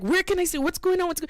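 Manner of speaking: high-pitched, mimicked clamour of questions; clamouring crowd. Facebook friends wanting to watch, mock clamour. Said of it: "everyone's like".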